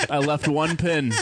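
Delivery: usual speaking voice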